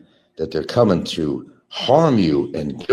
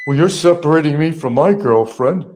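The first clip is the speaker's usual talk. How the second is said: raised, loud mock complaint; imitating Barry; 6 semitones higher, 6 dB louder than usual. Mimicking Barry's complaint about his girlfriend, mock protest. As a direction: quote him higher and louder, lightly mocking.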